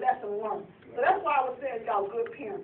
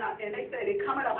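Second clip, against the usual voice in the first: usual speaking voice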